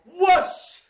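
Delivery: high, loud spell whoosh; magic whoosh. A narrator's reading. Storyteller casting a spell for children, loud high whoosh. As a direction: let the spell burst out high. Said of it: "picked up a magic wand and she went".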